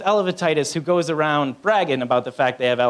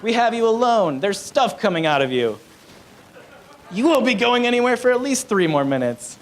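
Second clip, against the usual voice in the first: higher, excited mock cat voice; voiced cats; 7 semitones higher than usual. Cats cornering their owner on the toilet, high mock gloating. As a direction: play the cats pitched up, crowing. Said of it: "they're like".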